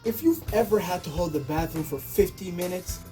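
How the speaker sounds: usual speaking voice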